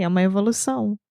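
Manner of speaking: ordinary delivery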